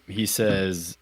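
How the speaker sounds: ordinary talk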